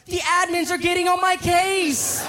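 high, drawn-out exasperated complaint; Harris. Retold or imitated. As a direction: imitate a friend whining angrily under pressure. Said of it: "angry I ams from Harris".

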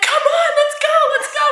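high, loud urging shout; excited calling out. Retold or imitated. Calling staff into the ocean, excited urging shout. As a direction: holler for friends to follow you in.

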